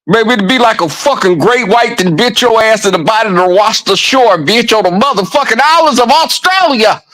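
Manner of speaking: playful threat rising to a high, hammed-up rant; retelling a fake threat to Ernest Rowe, mock angry and rising; mock threat, building. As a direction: talk trash bigger and bigger. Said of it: "I was like".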